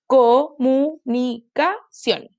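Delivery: "comunicación" slowly split into five beats; slow, separated syllables in "comunicación". Shown as a demonstration. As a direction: chop "comunicación" into CO-MU-NI-CA-CIÓN. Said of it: "polisílaba es aquella que tiene cuatro".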